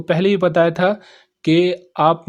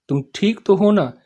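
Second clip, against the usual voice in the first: ordinary delivery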